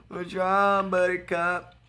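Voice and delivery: sugary, teasing put-on voice; condescending mock sympathy; mock taunt. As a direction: needle the losing opponent sweetly. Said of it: "I'm like".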